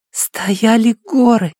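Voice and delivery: put-on high, slow dreamy child's voice; half-asleep little boy; sleep talking. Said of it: "словно сквозь сон сказал Джефф".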